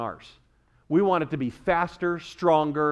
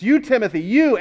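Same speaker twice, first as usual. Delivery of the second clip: "You" raised and loud; stress on YOU; 6 semitones higher, 6 dB louder than usual. Shown as a demonstration. Preacher turning the charge on Timothy, loud stress on "you". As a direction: punch both "you"s emphatically.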